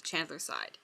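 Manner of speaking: plain reading voice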